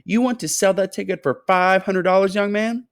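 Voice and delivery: high, loud indignant woman; shout. A narrator's reading. A woman in the crowd shouting her bigger offer, high and loud. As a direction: cry out in disbelief. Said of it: "shouted a woman".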